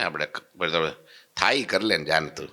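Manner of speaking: normal talking voice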